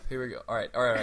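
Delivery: normal talking voice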